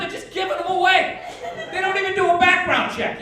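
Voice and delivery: ordinary talk, nothing marked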